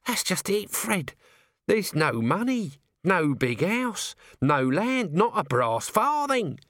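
a put-upon little man moaning his lot; Nobby, whining little corporal. A narrator's reading. Narrator voices Nobby.

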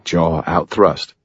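plain reading voice

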